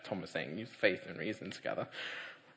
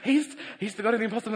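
excited mum; 12 semitones higher, 11 dB louder than usual. Retold or imitated.

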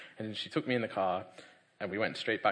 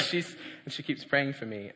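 ordinary talk, nothing marked

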